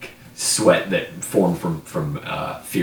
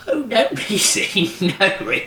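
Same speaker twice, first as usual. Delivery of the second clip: laughing; 4 dB louder than usual. Acted. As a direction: chuckle it off, much higher. Crone laughs off the question.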